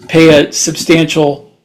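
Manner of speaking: ordinary talk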